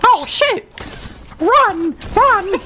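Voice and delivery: panicked shout. Real, not acted. Panicked in game.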